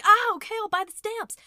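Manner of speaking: startled give-in. Retold or imitated. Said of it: "And I'm like".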